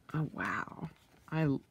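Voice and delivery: breathless whispered wow; awed whisper. Real, not acted. Marvelling at the leather texture, a soft whisper. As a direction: hush it to a whisper.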